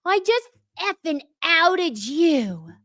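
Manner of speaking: mock yell. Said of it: "when she's yelling at Danielle".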